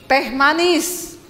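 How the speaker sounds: slow, drawn-out order; commanding husband. Acted. Solo husband ordering his wife, slow lordly command. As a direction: order it like a seated king. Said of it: "perintah istri".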